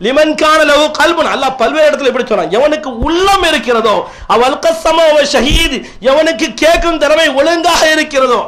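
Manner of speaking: high, ringing preacher's recitation; scriptural proclamation. Real, not acted. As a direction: proclaim verse and meaning to the congregation. Recites and translates scripture forcefully.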